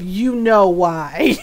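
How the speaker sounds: low, slow, pointed cat voice; knowing cat look. Acted. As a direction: draw it out as a smug reproach. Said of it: "Loki had this look of".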